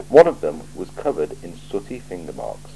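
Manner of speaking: usual speaking voice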